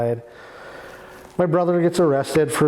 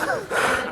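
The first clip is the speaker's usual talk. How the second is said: short high laugh; 3 dB quieter than usual. Real, not acted. Laughs at the job offer.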